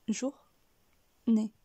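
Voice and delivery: JOUR, pause, NÉ, each on its own; journée cut into jour and né; split syllables. Shown as a demonstration. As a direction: say jour ... né with a clear gap. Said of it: "couper le mot en deux".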